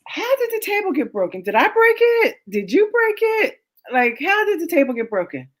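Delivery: high, incredulous questioning; mock interrogation. Wife grilling husband about the broken table, incredulous. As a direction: grill him in disbelief.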